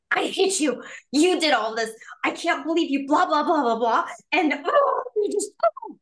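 high-pitched demo of an attacking rant; hammed-up furious accusation, tumbling into exasperation; accusing yell. Shown as a demonstration. Angry partner blaming their significant other, accusing yell. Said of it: "I'm showing the yelling example".

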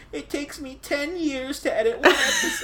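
put-on high, laughing old lady voice; a spry little old lady. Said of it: "what was that voice?".

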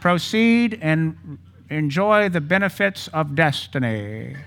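slow, high mock herald proclamation; town crier. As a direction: announce it like a royal herald.

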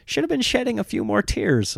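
high, wry mock whine; mock complaining dad. Dad scolding kids for too few tears. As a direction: tease the kids for not crying. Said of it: "I was like".

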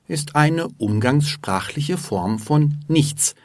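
ordinary talk

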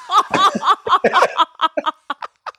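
high-pitched laugh, rising; high burst of laughter. Real, not acted. Laughing at the fireman's line, high cackle.

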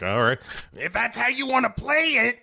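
high put-on voice; dopey detectives. Detectives leaving Sharon Stone, high mock voice. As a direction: say it like a defeated cop. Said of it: "they're like".

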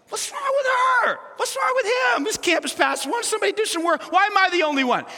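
high, shouted sassy griping; mock diva rant about lazy coworkers; hammed-up sassy outburst. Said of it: "this sassy thing going on".

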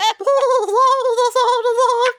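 high, wailing imitation of talking fish; funny fish-voice noise. Showing co-hosts how the fish talked, in a funny voice. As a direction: make silly high-pitched fish babble. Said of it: "is that what they were doing?".

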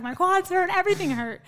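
loud, high-pitched mock whine; snapped protest. Athlete on the bike protesting to coach, loud high complaint. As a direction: complain at full tilt, replaying the outburst. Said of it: "And I literally snap reaction".

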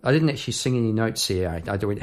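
usual speaking voice

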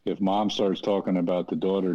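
ordinary talk, nothing marked

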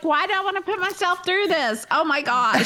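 mock dread. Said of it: "and now I'm like".

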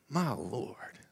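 soft, whispery imitation of a pastor's amazement; hushed, breathy interjection of surprise; Vance Ross. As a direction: murmur it in awe, mimicking the pastor friend. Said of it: "his voice is deep, resonant".

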